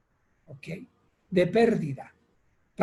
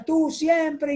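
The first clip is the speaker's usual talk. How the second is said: high, mildly raised mock yell; grito de reproche; 8 semitones higher than usual. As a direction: act a son lashing out at dad. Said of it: "le grita". (reproche agudo, gritado a medias)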